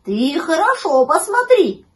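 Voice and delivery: slow, high, loud puppy voice; drawn-out puppy plea; pleading puppy. A narrator's reading. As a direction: insist anxiously as a little pup. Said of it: "попросил щенок".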